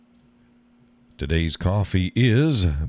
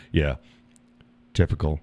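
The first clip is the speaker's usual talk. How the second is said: ordinary talk, nothing marked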